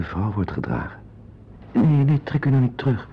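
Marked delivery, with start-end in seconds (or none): 1.7-3.1 higher and louder, pleading quickly; anxious begging; urgent plea. Acted. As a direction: urge her not to pull away. Pleading she not pull away.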